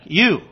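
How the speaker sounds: "You" punched high and loud; lone "You," stressed up high and loud; emphatic you. Shown as a demonstration. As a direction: hit "You" hard, you and none other. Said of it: "So it's emphatic".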